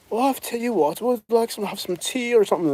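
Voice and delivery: high, soft mock English accent. Englishman talking to an Alabama man, high soft English accent. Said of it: "The guy from England would be like".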